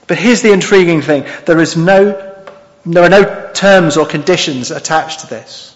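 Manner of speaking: ordinary talk, nothing marked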